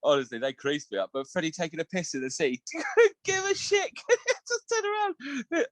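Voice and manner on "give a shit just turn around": laughing. Real, not acted. Laughing retelling son peeing.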